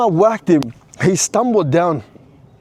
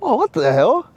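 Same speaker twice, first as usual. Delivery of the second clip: surprised thought; 6 semitones higher than usual. Retold or imitated. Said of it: "I actually thought in my head".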